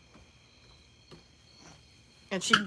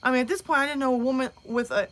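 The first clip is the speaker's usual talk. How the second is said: ordinary talk, nothing marked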